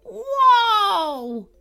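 loud, high, drawn-out cry; cry of whoa. A narrator's reading. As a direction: shout the horses to a halt. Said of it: "with a cry of".

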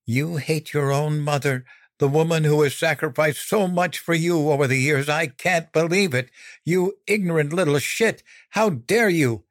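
high-pitched indignant mother; incensed, pitch climbing as it goes; outraged scolding. A narrator's reading. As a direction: berate the child in wounded disbelief. Mother furiously berates her son.

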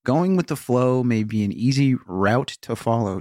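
normal talking voice

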